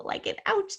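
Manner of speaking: a high unvoiced little "ouch"; mock ouch. Shown as a demonstration. Teacher mimics getting hurt, high and breathy. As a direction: squeak it like a tiny hurt. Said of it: "or ow".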